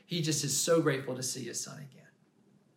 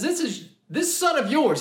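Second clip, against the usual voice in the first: higher and louder, bitter jab; angry older brother; 10 semitones higher, 6 dB louder than usual. Retold or imitated. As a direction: spit out the words as the mad brother. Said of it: "He's just so mad".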